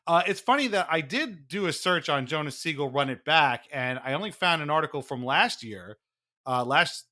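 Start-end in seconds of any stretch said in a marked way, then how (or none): none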